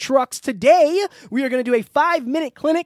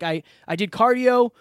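normal talking voice

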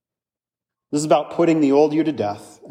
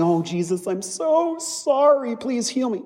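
much higher, whiny pleading, drawn out; mock groveling; 9 semitones higher than usual. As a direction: ham up a sinner begging for mercy. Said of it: "Did this man come groveling".

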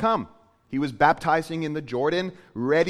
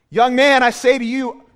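loud, higher command to the dead; commanding call; 7 semitones higher, 10 dB louder than usual. Acted. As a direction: call out with full authority. Jesus commanding the dead man.

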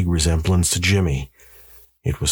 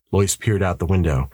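usual speaking voice

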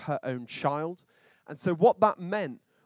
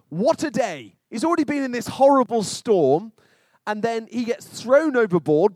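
loud build; 5 semitones higher, 7 dB louder than usual. Real, not acted. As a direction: voice rising, louder and higher. Preacher building Jonah's ordeal.

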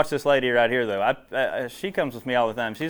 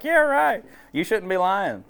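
sceptical guy; 9 semitones higher, 4 dB louder, slower than usual. Retold or imitated. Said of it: "one guy's like".

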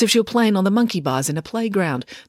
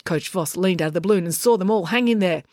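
ordinary delivery, nothing marked

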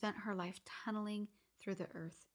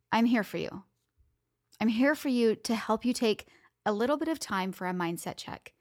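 loud upbeat host sign-off; podcast outro; 2 semitones higher, 14 dB louder than usual. Real, not acted. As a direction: speak straight to listeners, energized. Outro addressing listeners.

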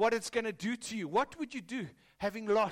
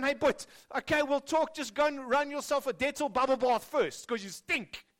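louder, higher fussy father voice; mock cautious father; 6 semitones higher, 6 dB louder than usual. Father lecturing his returning son about his smell, mockingly. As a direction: fuss at him with conditions, hammed up. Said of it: "He doesn't say to his son".

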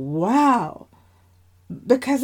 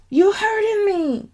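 much higher complaining whine; whiny sister; 11 semitones higher than usual. Acted. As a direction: complain that Dad is squeezing your hand. Sister in father's grip.

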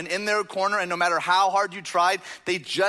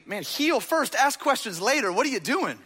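higher-pitched, mock-exasperated protest; baffled objection; 7 semitones higher than usual. Preacher voices his own thought on reading the verse, incredulous. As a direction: question Jesus's priorities in lively disbelief. Acts out baffled reaction to Jesus.

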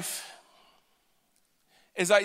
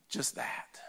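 whisper; 15 dB quieter than usual. Real, not acted. Hushed closing of a point.

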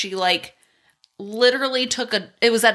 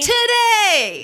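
loud, higher cheer; excited shout; 10 semitones higher, 5 dB louder than usual. Retold or imitated. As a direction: belt it like a pop singer. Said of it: "Robin Scherbatsky".

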